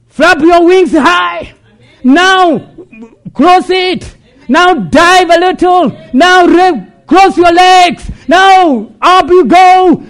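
drawn-out, slow shouted commands within preaching; full-force preaching. Real, not acted. Preacher voices eagle training her young, full-force preaching. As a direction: belt out each training command, stretching it.

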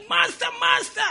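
high-pitched imitated boy's call; boy shouting. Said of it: "he heard the boy shouting".

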